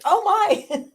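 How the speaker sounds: high, loud laughing surprise; surprised. Retold or imitated. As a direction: blurt it out with a laugh. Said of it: "I was like".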